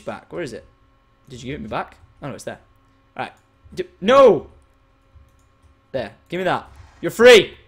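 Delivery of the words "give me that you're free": usual speaking voice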